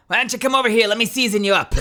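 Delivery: hungry goblin luring its dinner over. Acted. Goblin inviting the visitor to be seasoned, loud goblin voice. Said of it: "Why is the goblin Rick".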